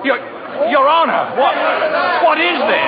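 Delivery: high, shocked shout at the sudden mess; startled outcry. Acted. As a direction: cry out in disbelief. Mail sacks dumped on desk.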